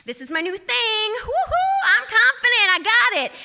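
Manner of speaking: pumped-up self pep talk, rising high on the woo-hoo; forced optimism. Retold or imitated. Walking home in rain, psyching herself up, forced cheer. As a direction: talk yourself into bravado in the rain. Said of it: "and I'm just like".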